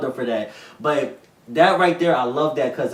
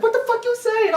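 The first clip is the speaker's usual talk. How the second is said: much higher, strained mock yell of an angry woman; furious woman; 4 dB louder than usual. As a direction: play the raging girlfriend mid-slap. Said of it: "She's like".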